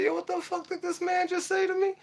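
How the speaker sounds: high, strained, disbelieving voice; can't believe what the principal said; stunned outrage. Retold or imitated. Hearing the principal's threat, high stunned outrage. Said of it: "I said".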